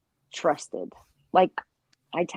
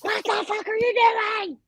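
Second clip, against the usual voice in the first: high mock New Jersey yell; New Jersey accent; 13 semitones higher, 4 dB louder than usual. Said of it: "practice my New Jersey accent".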